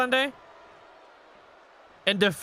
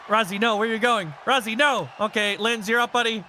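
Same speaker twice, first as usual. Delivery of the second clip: normal talking voice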